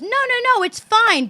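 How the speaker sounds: put-on high, breezy kid voice brushing off worry; imitating insistent girl. Young girl reassuring the mother, imitated high and insistent.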